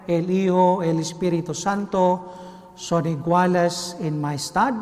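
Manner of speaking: usual speaking voice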